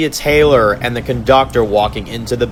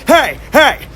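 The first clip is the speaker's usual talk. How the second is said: high, sudden cry to stop him; alarmed shout; slower than usual. Acted. Arlo shouts at the conductor to stop stabbing Tom.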